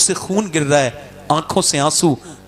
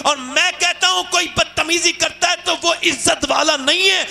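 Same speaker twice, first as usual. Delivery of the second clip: fiery preaching; 9 semitones higher than usual. Real, not acted. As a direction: thunder it out to the crowd. Preacher rising to full force. (پرجوش خطابت)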